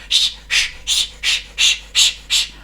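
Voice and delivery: whispered engine puffing shh; breathy puffs of a little engine; steam puffs. Acted. Narrator puffing like Thomas setting off.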